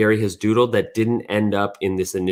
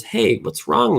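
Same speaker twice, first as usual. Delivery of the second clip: caring alien; 13 semitones higher, slower than usual. Acted. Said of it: "the alien says".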